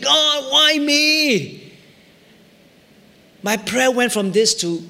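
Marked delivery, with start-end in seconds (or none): 0.0-1.6 high, pained demand of why me; angry plea. Retold or imitated. Pastor retelling his upset prayer to God, pained cry. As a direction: cry out in hurt protest. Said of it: "I was upset, angry. I said".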